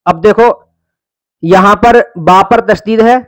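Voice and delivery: normal talking voice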